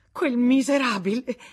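loud, high, bitter shout; furious outburst. A narrator's reading. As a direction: spit out the insult. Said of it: "Tutto a un tratto esplose". (esplosione di rabbia, gridata)

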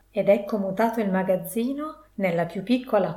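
ordinary delivery, nothing marked

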